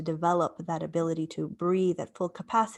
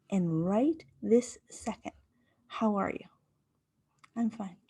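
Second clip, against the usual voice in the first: whispered self-talk; 5 dB quieter than usual. Retold or imitated. At the office door, whispering calm questions to herself. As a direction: whisper it to yourself before opening the door. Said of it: "And I went".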